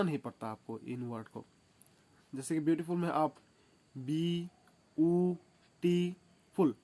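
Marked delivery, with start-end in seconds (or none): 4.0-6.7 बी, ऊ, टी, फुल said as separate beats with a pause between each; split into syllables. Shown as a demonstration. Teacher to students, splitting a word into syllables, each beat spoken apart. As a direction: break the word apart slowly, one syllable at a time. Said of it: "तीन part में भांगते हो".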